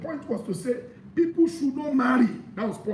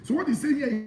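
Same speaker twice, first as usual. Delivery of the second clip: ordinary talk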